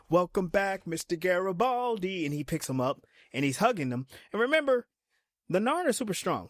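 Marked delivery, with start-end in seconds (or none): 0.1-2.3 hammed-up joyful welcome; Jakar's hearty greeting. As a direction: greet an old friend with a big flourish. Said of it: "He's like".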